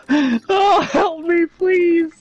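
high squeal like a dying rat; helpless laughter. Real, not acted. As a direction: plead weakly at the top of the pitch, mid laughing fit. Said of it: "You sound like a dying rat".

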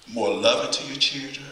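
ordinary talk

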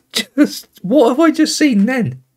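high pitched incredulous question; incredulous outburst. Real, not acted. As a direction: ask it as if you can't believe your eyes. Laughing disbelief at the goal.

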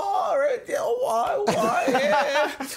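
very high, loud squealed reply; mock flustered bluffing. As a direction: act out a panicked, laughing bluff. Mimics his own panicked bluffing reply.

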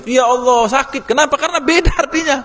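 high, pained cry; pained exclamation. Real, not acted. Teacher cries out at wrong Quran reading, high and pained. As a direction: wince aloud in mock pain.

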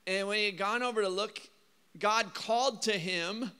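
ordinary delivery

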